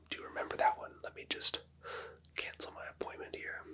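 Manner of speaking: ordinary talk, nothing marked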